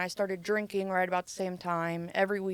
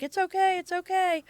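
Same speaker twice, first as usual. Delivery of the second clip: imitated high, raised-voice reassurance; retold urgent reassurance; 11 semitones higher, 4 dB louder than usual. Dad to crying child during raid, imitated high urgent reassurance. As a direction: call out to calm a crying child, pitched way up.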